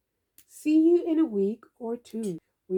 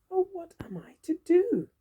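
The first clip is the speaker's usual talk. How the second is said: whisper; 7 semitones higher than usual. Acted. Worried aside in read-aloud story.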